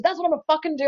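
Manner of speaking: high-pitched imitation of an insistent client; retold defiant outburst. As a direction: act out his stubborn outburst. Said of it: "screaming at me".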